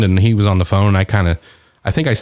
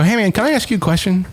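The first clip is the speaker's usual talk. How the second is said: high, tentative ask; tentative retold question; 9 semitones higher, faster than usual. Asking a comedian in the green room, high and tentative. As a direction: approach a colleague with a careful higher-pitched question.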